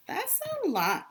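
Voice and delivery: high exaggerated wonder; amazed. Reading about giant squid to a child, she gasps high in wonder. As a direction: make the size sound huge.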